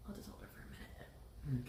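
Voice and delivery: hushed whisper. Real, not acted. Holding sleepy child.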